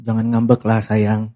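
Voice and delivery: low, soft and slow; tender soothing. Shown as a demonstration. Preacher models what to tell yourself, tenderly soothing. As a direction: gently coax yourself out of a sulk. Gentle self-talk for congregation to repeat.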